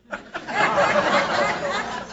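high breathy burst of laughter; laughs. Real, not acted. Storyteller laughing at his own drunken stunt, high breathy laugh.

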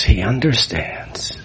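hushed whisper of realization; whispered awe. Real, not acted. A man realizing someone understands him, whispering. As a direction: breathe it out in wonder.

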